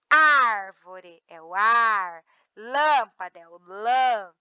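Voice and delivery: "Árvore", "ar", "lâmpada", "lã" slow and stretched out; syllables drawn out. Shown as a demonstration. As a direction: drag out the stressed "ar" and "lã". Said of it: "a sílaba tônica é a antepenúltima". (sílabas tônicas "ar" e "lã" bem alongadas)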